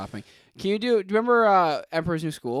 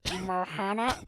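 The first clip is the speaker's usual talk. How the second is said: slow, strained alien voice attempt; a hammy go at Stitch's growl; 7 dB quieter, slower than usual. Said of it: "It wasn't a horrible start".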